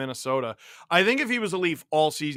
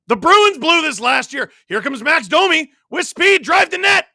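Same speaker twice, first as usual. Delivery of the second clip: high, loud shouted call; urgent raised-pitch commentary; excited play-by-play; 11 semitones higher, 8 dB louder than usual. Real, not acted. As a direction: shout the rush as it happens. Excited live call of a rush.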